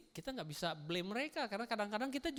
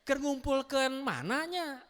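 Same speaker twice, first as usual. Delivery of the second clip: Sundanese man; 5 semitones higher, 3 dB louder, slower than usual. Acted. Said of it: "Kalau dari Sunda".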